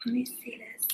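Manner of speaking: whispered aside to herself. Real, not acted. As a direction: mutter it under breath, unvoiced. Checking stream setup.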